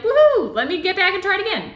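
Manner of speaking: high, loud gleeful whoop; excited toddler. Acted. Kid who fell learning to walk, excited. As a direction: cheer like an undaunted kid, quick and high. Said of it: "It's like a kid learning how to walk".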